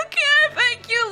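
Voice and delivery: high, tearful mock thanks; hammed-up weepy squeak; retold crying. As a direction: imitate answering through tears. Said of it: "I broke out in tears".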